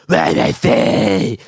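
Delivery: low, slow, limp attempt at a threat; mock weak villain voice. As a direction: imitate a villain with no menace at all. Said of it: "at least have a menacing voice".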